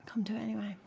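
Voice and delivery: unvoiced playful whisper. Streamer slips chat a plug in a playful whisper. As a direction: whisper the invite like a secret.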